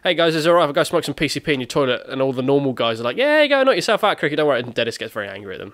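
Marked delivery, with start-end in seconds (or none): 0.0-2.0 higher, louder call to the room; hammed-up cheery asking; mock breezy request. Retold Cricket asking the bar staff. 3.2-4.6 very high, loud group holler; over-the-top easygoing shout; mock cheery approval. Retold normal guys cheerfully agreeing.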